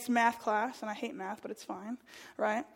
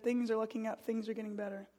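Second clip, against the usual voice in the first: quiet hopeful reassurance, softer; teacher softly reassuring, remembered, softer; 2 semitones lower, 8 dB quieter than usual. Retold or imitated. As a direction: say it gently like a kind teacher. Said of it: "Mister Spurline said".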